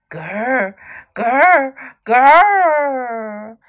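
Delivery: growls swelling into a long loud one; growling. A narrator's reading. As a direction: growl like an unseen beast, building up. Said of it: "The growling".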